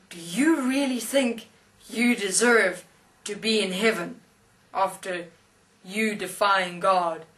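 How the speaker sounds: loud, imperious challenge, pausing between phrases; stern huge angel. Retold or imitated. Huge angel challenging the atheist before heaven, stern and loud. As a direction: play a mighty angel demanding an answer. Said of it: "big angel standing there and says".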